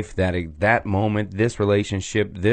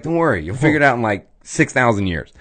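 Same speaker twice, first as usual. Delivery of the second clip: fast breezy God; 2 semitones higher than usual. Retold or imitated. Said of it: "He's like".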